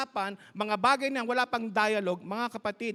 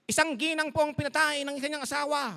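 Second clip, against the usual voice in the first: higher, announcer-style news read; news anchor; 6 semitones higher, faster than usual. Retold or imitated. Preacher imitating the TV anchor reading the headline. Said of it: "sabi niya ganon".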